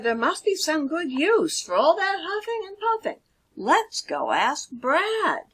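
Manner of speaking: an idea pops, share it; eager pig Hal. Acted. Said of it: "Hal said".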